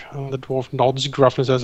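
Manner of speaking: ordinary talk, nothing marked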